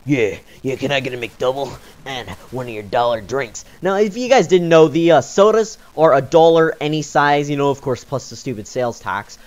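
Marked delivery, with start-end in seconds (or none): 0.1-3.7 gruff customer. Retold or imitated.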